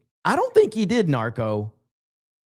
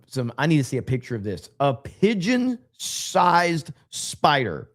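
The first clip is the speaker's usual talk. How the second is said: usual speaking voice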